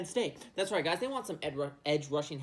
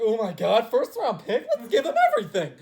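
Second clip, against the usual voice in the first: giddy, laughing and much higher; squealing with glee over the offer; 49ers front office; 4 semitones higher, 9 dB louder than usual. Retold or imitated. 49ers brass thrilled by a first round pick, laughing giddily. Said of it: "they're gonna be like".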